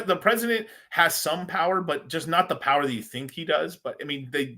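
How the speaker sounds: ordinary talk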